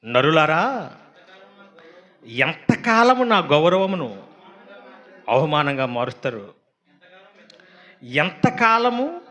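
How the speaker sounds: very slow, loud drawn-out ఎంతకాలము; solemn psalm reading. Acted. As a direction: plead the verse with weight. Reads the psalm aloud. (నెమ్మదిగా గంభీరమైన కీర్తన పఠనం)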